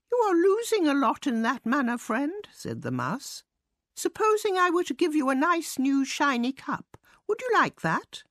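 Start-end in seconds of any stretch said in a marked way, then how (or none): 0.1-2.5 high-pitched little mouse; helpful mouse. A narrator's reading. 3.9-8.2 high little mouse making an offer; bargaining mouse. A narrator's reading.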